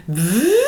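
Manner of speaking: gliding V buzz; buzzing lip exercise. Shown as a demonstration. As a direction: slide a buzzed V upward at the lips. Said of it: "putting the emphasis at the lips".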